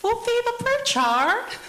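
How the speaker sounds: put-on very high, loud, slow Palangi accent; drawn-out put-on Palangi query, rising at the end; Palangi phone voice. Caller hiding who she is from mother-in-law, Palangi voice. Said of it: "put on my Palangi voice so she won't know it's me".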